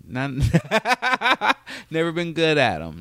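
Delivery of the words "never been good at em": laughing. Real, not acted. Laughing at own admission.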